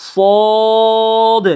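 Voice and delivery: long, full-mouthed "সদ"; thick heavy sound. Shown as a demonstration. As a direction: intone "সদ" as a heavy sooood. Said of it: "সদটাকে মোটা করে দিয়ে পড়তে হবে".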